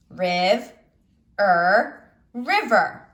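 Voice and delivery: Riv, er, then river, sounded out slowly; teacher blends the parts into river; syllable by syllable. Shown as a demonstration. Teacher sounding out syllables for the class. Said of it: "let's read syllable by syllable".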